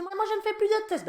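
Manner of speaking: put-on little girl's whine. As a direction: squeak it like a stubborn little girl. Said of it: "ma fille derrière qui faisait".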